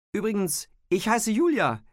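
hoher, lauter Zuruf; Nachrufen. A narrator's reading. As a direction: jemandem hinterherrufen, der weggeht. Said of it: "hatte sie ihm nachgerufen". (laut und hoch nachrufend)

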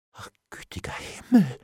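breath-only exclamation; whispered dread. A narrator's reading. Bailiff dreads the law, whispering in shock. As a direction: gasp it in a whisper.

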